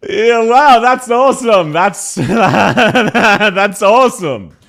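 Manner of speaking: loud, high, laughing mock delight; laughing sarcastic praise. Real, not acted. Streamer reacting to a bad accent clip, loud sarcastic laughter. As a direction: break up laughing while praising it.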